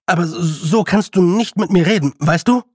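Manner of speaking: put-on indignant young lord, faltering then forceful; flustered protest. A narrator's reading. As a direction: protest hotly, tripping over your first words.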